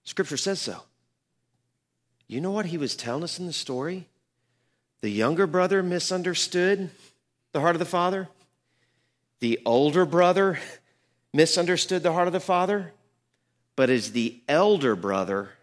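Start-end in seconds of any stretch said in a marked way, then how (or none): 5.0-15.5 louder and higher, driving the point home; full-force preaching. Acted. Pastor preaching to his congregation about the two brothers, louder and higher.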